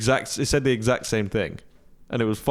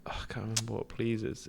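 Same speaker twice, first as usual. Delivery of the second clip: whisper; 11 dB quieter than usual. Real, not acted. Frustrated aside, muttered to self.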